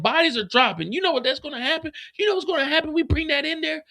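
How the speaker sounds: high, fast panicked woman's voice; mock panic, high and rushed. CO warning Diamond about bodies dropping, high panicked voice. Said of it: "She said".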